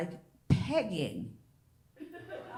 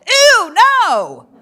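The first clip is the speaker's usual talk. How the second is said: loud, high disgusted squeal; 9 semitones higher, 16 dB louder than usual. Acted. Comic to crowd, a loud disgusted squeal. Disgusted outburst at joke premise.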